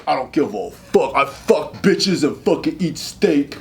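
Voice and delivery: lightly voiced macho jock; slow, mock tough-guy boast; manly. Studly recruits boast about themselves, imitated manly voice. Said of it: "They just feel so manly".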